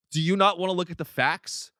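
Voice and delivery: usual speaking voice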